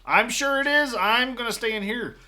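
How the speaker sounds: high, loud refusal; calling back. Acted. Terry insists on staying put, calling back high. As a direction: shout back, pitched well up.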